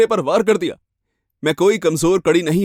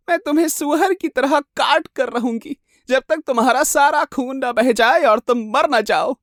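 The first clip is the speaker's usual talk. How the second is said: high, menacing madwoman voice; crazed murderous wife; 10 semitones higher than usual. Acted. Jealous wife threatening to butcher her rival, high and crazed. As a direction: relish the threat, stretching it out up high.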